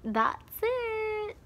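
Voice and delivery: squeaky high wrap-up; high sign-off. Real, not acted. To viewers ending the story, high chirpy. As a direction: chirp it out to end.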